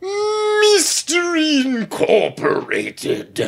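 high, slow, drawn-out yell. Shown as a demonstration. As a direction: bellow it from across the room. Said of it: "lean back from the microphone and yell Mystery Incorporated".

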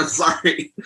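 laughing through choking on spit; embarrassed laugh. Real, not acted. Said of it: "I'm choking on my own spit".

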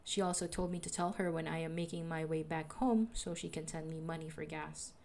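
ordinary talk

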